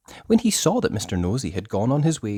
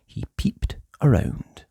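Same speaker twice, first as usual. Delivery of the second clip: plain reading voice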